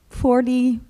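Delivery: ordinary delivery, nothing marked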